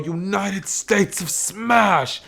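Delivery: hammed-up hero attack call; battle cry. Hero blitzing the villain with a punch, battle cry. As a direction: belt it out like a finishing move.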